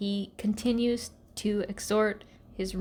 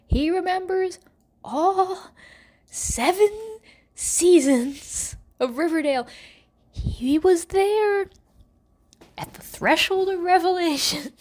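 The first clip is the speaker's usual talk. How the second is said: dramatic build; 7 semitones higher, 4 dB louder than usual. Real, not acted. Dramatic recap of memories.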